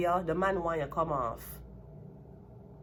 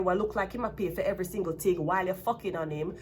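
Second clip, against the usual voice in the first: usual speaking voice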